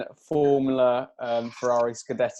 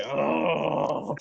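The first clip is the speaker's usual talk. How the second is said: growling like a dog. Retold or imitated. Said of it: "start growling at us like a fucking dog".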